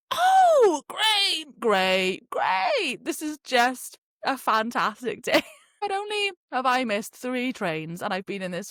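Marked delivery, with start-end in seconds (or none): 0.1-5.4 sarcastic. Retold or imitated. Locked in cubicle, sarcastic.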